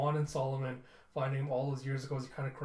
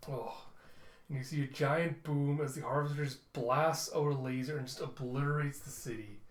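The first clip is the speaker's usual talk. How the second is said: normal talking voice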